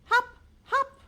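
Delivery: squeaky high bird hop. Acted. Reading to a child, high chirpy hop. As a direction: bounce the word up like a bird.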